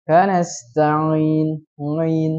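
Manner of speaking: Kanasta'in slowly with ain turned into ngain. Shown as a demonstration. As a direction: say the ain as nga. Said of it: "membaca ain menjadi ngai". (ain dibaca ngain)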